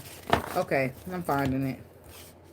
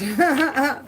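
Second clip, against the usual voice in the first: a short, loud, higher laugh; 9 semitones higher, 6 dB louder than usual. Real, not acted. Vlogger laughing at a go to church comment, loud laugh.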